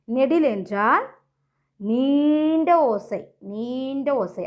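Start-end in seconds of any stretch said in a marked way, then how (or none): 1.8-4.5 நீண்ட stretched very slowly; teacher lengthening நீண்ட to show length; drawn-out long sound. Shown as a demonstration. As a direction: hold the first vowel of நீண்ட. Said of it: "அதிக சத்தத்தோடு உச்சரிக்கணும்".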